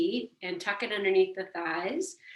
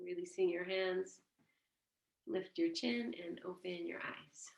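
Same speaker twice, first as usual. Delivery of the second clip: much softer, almost whispered guidance; hushed meditation close; 14 dB quieter than usual. Acted. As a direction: bring them gently back. Closing a silent meditation.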